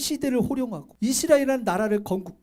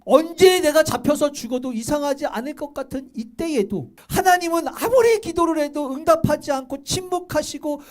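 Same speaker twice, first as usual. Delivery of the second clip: usual speaking voice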